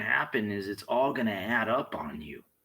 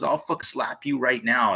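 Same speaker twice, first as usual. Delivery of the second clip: loud threat, a bit higher; retold loud threat; 5 semitones higher, 8 dB louder than usual. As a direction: bark the threat back as he said it. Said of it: "he goes".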